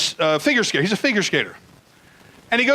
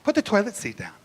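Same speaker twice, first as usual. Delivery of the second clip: even softer repeated nag; nagging girlfriend, fading; 6 dB quieter than usual. Retold or imitated. Girlfriend nagging about the toilet seat, fading softer. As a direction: let the nag trail off quietly. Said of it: "She was always bothering me".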